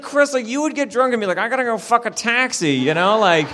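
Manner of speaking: high, loud, laughing tease; mock him through laughter. Friend teasing Chris about his drunk Spanish, laughing.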